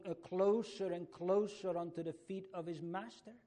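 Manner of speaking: high, loud impassioned preaching climax; the preacher at the climax of his sermon, loud and high; full-force preaching. Acted. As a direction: pour it out at full force, pleading and driving.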